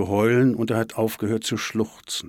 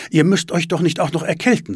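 higher, louder worried scolding; fussing mother; 8 semitones higher, 6 dB louder than usual. A narrator's reading. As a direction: fuss over them like a mother. Mother fussing over children in coats. (besorgt mahnend)

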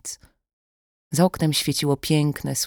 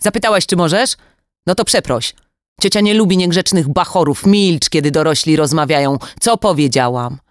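scolding shout; 3 semitones higher, 6 dB louder than usual. Acted. Kidnapper scolding the kidnapped daughter, loud and harsh. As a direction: bark at the girl like a harsh aunt. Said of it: "krzyknęła karcącym głosem".

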